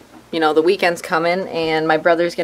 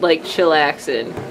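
ordinary talk, nothing marked

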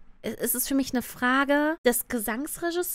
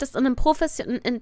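normal talking voice

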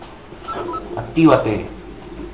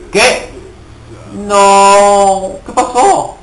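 high, loud startled "what?"; sudden shocked yelp; startled outcry; 6 semitones higher, 6 dB louder, much slower than usual. Real, not acted. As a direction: caught off guard, blurting out. Gamer startled by sudden death.

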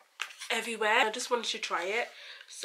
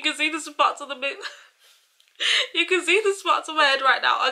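laughing through the words, higher; 4 semitones higher, 5 dB louder than usual. Real, not acted. To her viewers about her spots, laughing. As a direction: giggle while pointing out spots.

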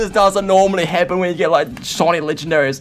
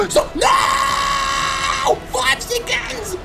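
very high, drawn-out cry of dismay; panicked scream; much slower than usual. Real, not acted. Gamer seeing only five seconds left, panicked scream. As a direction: shriek as the clock hits zero. Timer running out.

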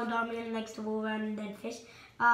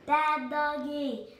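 slow, drawn-out mock-stern scolding; 4 semitones higher than usual. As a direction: drag out each word at the naughty dog. Scolding the dog.

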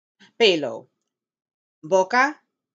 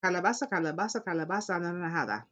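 quick, softer tongue-twister run; fast tongue test; 5 dB quieter, faster than usual. Shown as a demonstration. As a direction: rattle off CALABAZA ANARANJADA quickly. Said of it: "How fast can you do it?".

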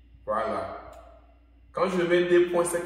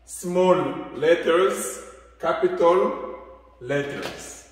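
slow recap; slower than usual. Shown as a demonstration. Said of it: "Voilà".

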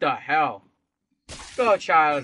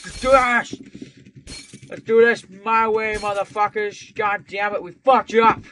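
hyped battle cry; 5 semitones higher, 7 dB louder than usual. Real, not acted. Pumped for retry.

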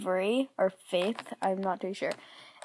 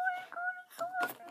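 much higher, quick and breathy; excited squeal; 10 dB quieter, faster than usual. Real, not acted. Overjoyed by the gift, fast high squeal. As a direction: gush it out fast.

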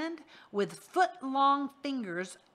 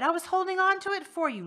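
high, fast, frantic excuse; desperate pleading; 11 semitones higher, 8 dB louder, faster than usual. Acted. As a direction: gabble to save your skin. Rictus pleads for his life.

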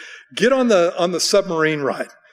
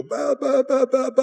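high mock bleat of a bored sheep; playful baa baa sheep noise; 9 semitones higher than usual. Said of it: "a bunch of sheep who go".

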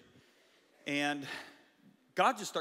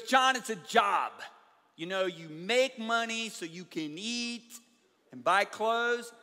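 retold annoyance; 6 semitones higher, 5 dB louder, slower than usual. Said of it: "he got a little mad at me".

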